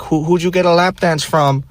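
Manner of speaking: high, demanding stripper voice; mock the stripper's suspicious question up high; imitating the stripper. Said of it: "she's like".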